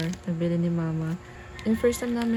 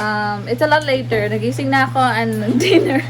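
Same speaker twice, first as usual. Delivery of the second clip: excited; 4 semitones higher, 10 dB louder than usual. Real, not acted. Loud excited vlog intro.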